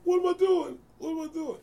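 put-on young bassist asking the bandleader, high and helpless; mock bewilderment. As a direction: play your lost younger self. Retelling his own bewildered reply.

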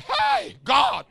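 high shouted "hey" to get attention; mock outcry to God. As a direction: call out high and loud, imitating a complaint. Mimics person crying out to God.

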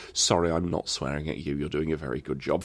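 a quick placating sorry to the cox; mock polite apology. Rowing, he softly reassures the cox mid-grumble. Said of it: "I spent the entire time going".

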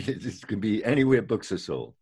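chuckling through the words; amused, laughing while echoing. Real, not acted. Host repeats guest's line, laughing.

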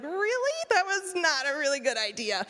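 high rising "Really?", then a firm verdict; doubtful afterthought. Retold or imitated. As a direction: question it in high disbelief, then settle down. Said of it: "I was like".

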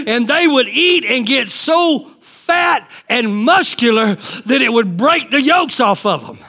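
ordinary talk

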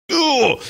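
high-pitched exasperated "ugh"; frustrated groan. Acted. Frustration at bad drivers, acted.

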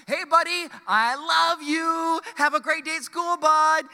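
high, loud, drawn-out calling from a car window; hammed-up doting shout; embarrassing dad yell. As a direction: holler lovingly to embarrass your kid. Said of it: "I just yelled as loud as I could".

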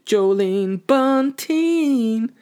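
long, high mocking call of the name; drawn-out name. Podcaster mockingly calling out a councillor's name, high and slow.

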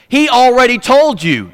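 like a parent losing patience; exasperated. Retold or imitated. Preacher voicing Moses scolding grumbling people, exasperated and loud. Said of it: "He doesn't say it like this".

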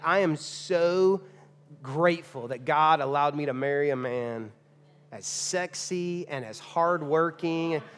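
slow, drawn-out mock adoration; hammed-up gushing praise. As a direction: savour each compliment, very slowly. Models wife's lavish praise of husband.

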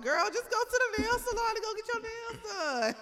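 high-pitched, laughing exasperation; failed code switch. Retold or imitated. Telling a nail-biting coworker off, unfiltered exasperation. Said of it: "I told y'all I can't code switch".

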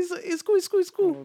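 high-pitched teasing echo; mock your friend's breezy brush-off; mimicked reassurance. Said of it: "You, you were saying".

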